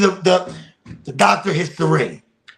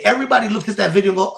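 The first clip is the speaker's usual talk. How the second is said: ordinary talk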